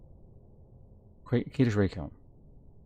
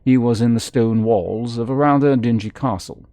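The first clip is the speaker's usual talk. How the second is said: lively read-aloud narrator voice; storybook reading, a touch dramatic; story narration; 4 semitones higher, 5 dB louder than usual. Acted. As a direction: read the book out like telling a tale. Reads game book aloud.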